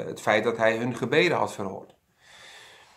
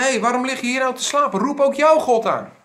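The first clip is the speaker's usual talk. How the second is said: fast, high, louder urgent wake-up call; urgent sailors; 12 semitones higher, 5 dB louder than usual. Acted. Ship captain rouses sleeping Jona, urgent and fast. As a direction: shake him awake, rushed and pressing.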